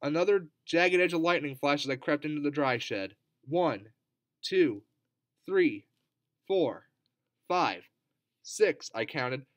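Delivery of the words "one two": usual speaking voice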